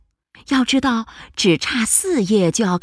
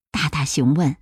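usual speaking voice

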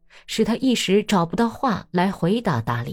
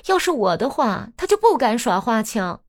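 raised, scornful rebuke, quickening at the end; indignant scolding; 9 semitones higher than usual. Acted. Daria berating meek Natalya for not confronting her husband, indignant. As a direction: shake her for being a pushover.

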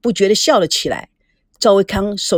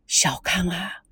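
hushed, whispery careful opening; soft, tentative near-whisper; cautious; 3 semitones lower, 8 dB quieter, slower than usual. A narrator's reading. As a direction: tiptoe into it, very softly. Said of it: "小心翼翼地说".

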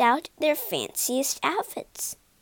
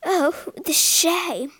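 slow, louder, crying the words; sniffling; 4 semitones higher, 4 dB louder, slower than usual. Acted. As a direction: weep in shame over her bald head. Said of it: "sniffed Miss Lacy".

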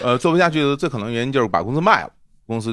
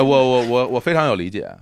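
normal talking voice

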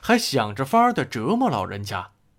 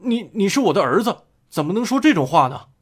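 fast, louder stammering alarm; stunned father, words tumbling out fast and loud; shocked father; 6 semitones higher, 5 dB louder, faster than usual. A narrator's reading. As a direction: caught off guard, blurting it out. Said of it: "爸爸大吃一惊".

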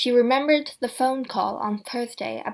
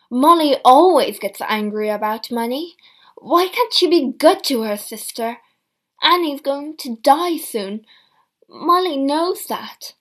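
aggrieved; 3 semitones higher, 9 dB louder than usual. A narrator's reading. Complaining about sister.

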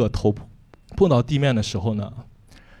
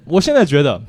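ordinary talk

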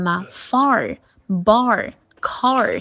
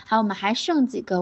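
ordinary talk

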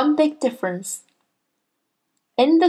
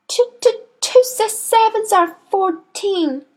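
gasped; 13 semitones higher than usual. A narrator's reading. Boy dangled by the headmistress gives his answer, gasping. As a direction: stammer it out high, as if in pain. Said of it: "gasped Rupert".

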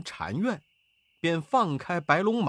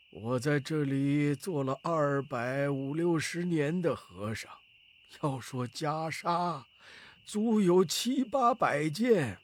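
put-on old abbot voice, drawing out the boast; boastful old abbot. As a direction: play an elderly monk showing off.

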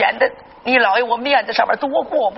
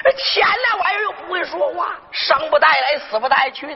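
exasperated outburst; 8 semitones higher than usual. Acted. Old grandfather scolding about money, shouting. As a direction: rant the question at the top of the voice.

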